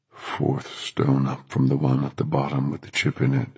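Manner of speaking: low, commanding stranger's voice; strange deep voice. A narrator's reading. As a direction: speak low in a voice not your own. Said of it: "In a strange, deep voice that was nothing at all like his usual voice".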